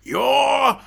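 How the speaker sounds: loud boss's bellow, rising to a high pitch; mock-furious shouted impression, drawn out; Vince McMahon. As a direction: bellow it like an irate boss firing someone. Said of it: "you could hear Vince McMahon".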